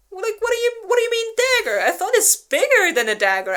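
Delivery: baffled disbelief. Retold or imitated. Listener hears dagger and can't believe it, high baffled protest. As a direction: squeak it out in total confusion. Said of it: "and I was like".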